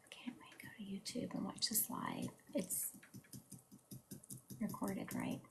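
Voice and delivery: almost silent whisper of a comment; whispered reading. Real, not acted. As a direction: mouth the comment under your breath. Reading viewer comment under breath.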